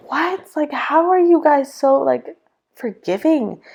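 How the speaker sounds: high, incredulous questioning; bewildered. Retold or imitated. Questioning how others forgive God, bewildered.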